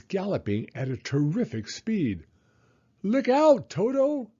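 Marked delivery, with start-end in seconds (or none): 3.0-4.3 high, loud cry of alarm; warning shout. A narrator's reading. Hippo warns Toto of the crocodile, loud shout. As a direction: yell it to save the boy. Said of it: "shouted Humpy Rumpy".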